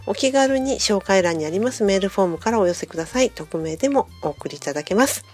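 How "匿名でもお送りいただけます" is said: ordinary talk, nothing marked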